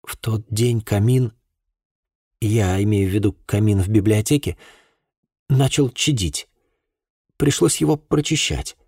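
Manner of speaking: hesitant storytelling with long pauses; slightly halting start. A narrator's reading. Girl starting her painful account to an investigator, haltingly. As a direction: begin haltingly, breaking between phrases. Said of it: "Рэйчел начала немного сбивчиво".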